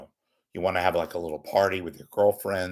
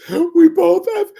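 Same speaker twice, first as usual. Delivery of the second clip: very high, loud, wailing mock Indian accent; 11 dB louder than usual. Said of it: "I don't really do Indian impressions, but I'll try".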